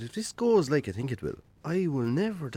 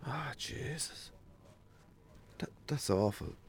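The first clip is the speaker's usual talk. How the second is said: shocked sympathy; 10 dB quieter, slower than usual. Acted. Hearing of brother's death.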